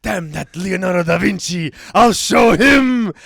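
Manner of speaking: loud, high theatrical vow of revenge; mock-furious rival artist; hammed-up indignation. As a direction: cry it out, drawing out the last words. Michelangelo vows revenge on his rival.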